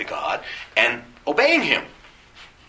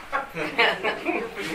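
soft laugh; 8 semitones higher, 7 dB quieter than usual. Real, not acted. Laughs at the absurdity.

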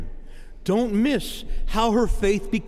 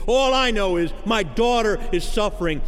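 ordinary talk

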